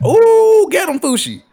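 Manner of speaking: high, loud excited "ooh" and urging; whooping, fired-up egging on; hyped cheering. Retold or imitated. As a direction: cheer on a fighter from the couch. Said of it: "'Cause I was hype".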